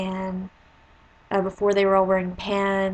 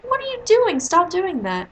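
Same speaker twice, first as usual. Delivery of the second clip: very high, loud shocked outburst; teacher catching two kids touching, shouting at them to stop; alarmed teacher; 9 semitones higher, 3 dB louder than usual. Retold or imitated. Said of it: "I was like".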